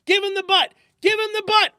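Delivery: very loud, high roar. Acted. F rushing up to Jones, roaring orders. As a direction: bellow the order as you run up. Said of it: "roared F".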